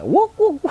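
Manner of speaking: high-pitched "wuk wuk" barks; playful high yelps in imitation; imitated barking. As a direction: mimic a streamer's excited barks. Imitating streamer's barking noise.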